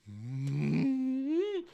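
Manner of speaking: soft hum leaping up in pitch; imitated magic hum. Sauron putting the illusion whammy on, imitated rising hum. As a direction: hum a rising mock spell. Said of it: "He just, just goes".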